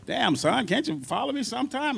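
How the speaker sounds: put-on a touch of an old bandleader scolding; imitating B B King. Bandleader scolding his drummer on stage, mock stern. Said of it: "He's like".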